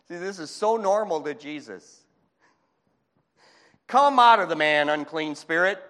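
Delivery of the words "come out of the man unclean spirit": loud, forceful command; statement of power. Acted. Jesus commanding the unclean spirit, powerful and loud. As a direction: order it out with loud authority. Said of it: "from a statement of power".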